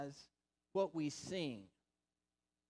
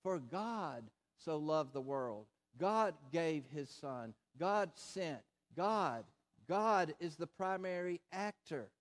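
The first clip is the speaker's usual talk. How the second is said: God punched on every line, slow and weighty; stress on God. Acted. Preacher to congregation, stressing God, slow and heavy. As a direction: lean hard on each God, slowly. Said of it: "puts his emphasis on God".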